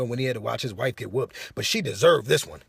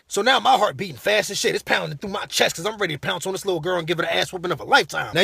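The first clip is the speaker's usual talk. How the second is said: ordinary delivery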